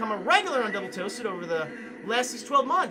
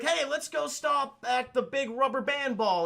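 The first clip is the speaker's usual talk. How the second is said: put-on higher, slow eager kid asking; eager Sonic; 7 semitones higher, 3 dB louder, slower than usual. Sonic begging Marsden to stop, lightly voiced high kid. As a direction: plead like a kid spotting a roadside attraction. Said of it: "Sonic, like".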